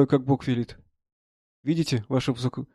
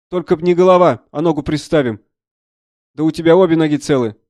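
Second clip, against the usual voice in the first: loud, higher, hearty voice; bluff army doctor; 3 semitones higher, 7 dB louder than usual. Acted. Doctor examining a soldier's wounded leg, loud and bluff. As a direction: brush the injury off loudly.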